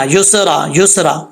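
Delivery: युसरा said quickly as "yu-se-ra"; wrong qalqala. Shown as a demonstration. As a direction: bounce off the "स" in each युसरा. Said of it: "सीन में कलकला हो जाएगा". (युसरा में सीन पर कलकले की गलती)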